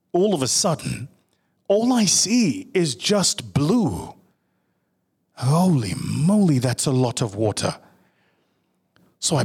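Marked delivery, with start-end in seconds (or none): 1.7-4.1 amazed, drawn-out and a bit higher; awed and slow. Acted. Awed realization. 5.3-6.6 slow, louder gasp of shock; shocked exclaim. Real, not acted. Shocked at the deep blue.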